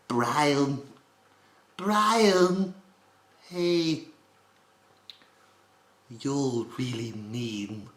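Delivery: high, slow British cartoon voice without gravel; measured British baby-villain impression; Stewie Griffin. Shown as a demonstration. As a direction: draw each phrase out, high and British. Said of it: "eliminating the gravel that I have normally in my voice".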